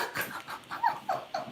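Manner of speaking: very high breathless laugh; squealing laugh. Real, not acted. Woman laughing at her own story, high squealing laugh.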